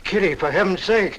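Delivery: high, loud plea; anguished protest. Acted. Suitor begging Kitty to stop, anguished and loud. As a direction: cry out for her to stop. Man protests her cruel outburst.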